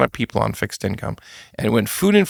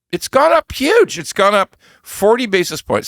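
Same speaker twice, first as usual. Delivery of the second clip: excited; 9 semitones higher, 3 dB louder than usual. Real, not acted. Stressing big jump in yields.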